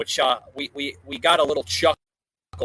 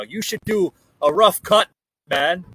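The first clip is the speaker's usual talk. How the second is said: badly faked Irish accent, higher and louder; 4 semitones higher, 6 dB louder than usual. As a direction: attempt a hammy Irish accent. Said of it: "worst impersonation of an Irish accent ever".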